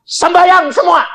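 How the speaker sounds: high, loud stern command; angry pastor. Retold or imitated. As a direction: order the congregation to pray. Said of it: "sepedeta marah".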